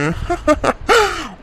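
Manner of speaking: high, gloating cackle; gloating laugh. Acted. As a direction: laugh in triumph at their helplessness. Villain gloats over a secret.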